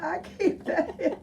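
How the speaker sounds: chuckling through the words; laughing. Real, not acted. Teacher remembering a pupil's secret, laughing. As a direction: laugh as you say it.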